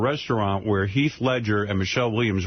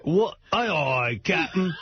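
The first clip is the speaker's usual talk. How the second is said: higher, hammed-up Australian pirate voice; mock pirate Australian; 6 semitones higher, slower than usual. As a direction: play a jokey Australian pirate. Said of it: "I'm gonna be robbed by a pirate".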